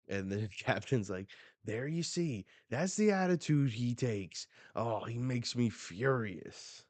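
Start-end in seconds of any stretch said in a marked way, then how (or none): 1.6-6.8 higher, louder indignant complaint; hammed-up outrage; acted exasperated fury. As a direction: play an exasperated captain venting. Voices the captain's furious complaint.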